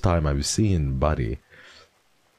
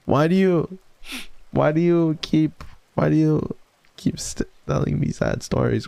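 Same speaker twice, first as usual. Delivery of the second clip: whiny complaint; 11 semitones higher than usual. Real, not acted. Complaining to chat.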